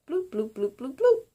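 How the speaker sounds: bubbly bloops, the last one shooting high; imitated toilet bloop. Telling listeners about the overflowing toilet, bubbly mock bloops. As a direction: mimic the toilet gurgling, rising at the end. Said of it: "I hear".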